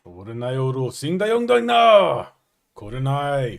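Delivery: hammed-up mock Japanese, swelling in pitch; fake Japanese. Shown as a demonstration. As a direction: declaim nonsense as mock Japanese. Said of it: "you do a good fake Japanese".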